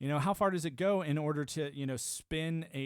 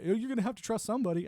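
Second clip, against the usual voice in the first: high, fast mock of the usual advice; mocking quote; 7 semitones higher, 3 dB louder, faster than usual. Mocking people who say you must trust somebody, higher and faster. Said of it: "this whole idea".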